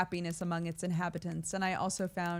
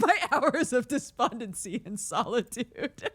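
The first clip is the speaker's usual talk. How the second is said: laughing through narration; 5 semitones higher, 5 dB louder than usual. Real, not acted. Laughing at her own reading.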